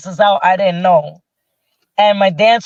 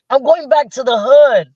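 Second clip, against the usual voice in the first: raised, animated comic complaint; worried protest; 5 semitones higher, faster than usual. Retold or imitated. Telling the producer she'll get robbed, mock alarm. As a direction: rant in mock alarm, getting higher.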